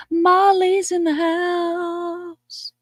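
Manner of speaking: high, very slow announcement; announcer call. Real, not acted. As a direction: stretch out the welcome. Announcing viewer, drawn out.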